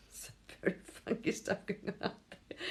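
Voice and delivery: ordinary talk, nothing marked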